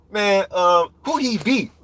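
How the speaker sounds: high-pitched mocking excuse; mock dismissive comeback, high; excuse-making fighter. Voices fighter dodging a fight.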